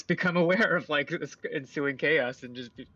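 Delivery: normal talking voice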